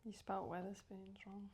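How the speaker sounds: soft whispered tease; whispering. Real, not acted. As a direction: whisper it teasingly. Teasing aside to host.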